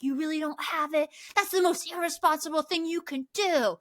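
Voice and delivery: mock accusing boys. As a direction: scold her like the angry boys. Boys attack her over map.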